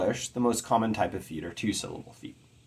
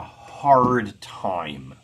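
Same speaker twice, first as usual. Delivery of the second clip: hard time with both words hit hard and slow; equal stress; 6 dB louder than usual. Shown as a demonstration. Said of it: "two syllables that are equally stressed".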